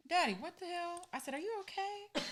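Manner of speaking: high and soft, worried; worried daughter. Retold or imitated. Daughter asks her nervous dad on FaceTime, high and soft. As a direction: pitch it way up and soften it with concern. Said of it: "And I'm all".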